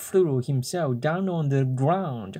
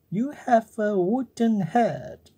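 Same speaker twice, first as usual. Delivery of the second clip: mocking cricket; 8 semitones higher than usual. Cricket insults puppet.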